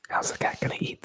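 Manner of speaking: ordinary delivery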